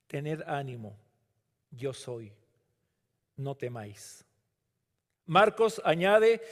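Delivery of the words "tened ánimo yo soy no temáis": soft, intimate near-whisper, falling at the ends; softer hushed quote, fading away; hushed words of Jesus. Acted. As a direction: breathe it gently like a promise.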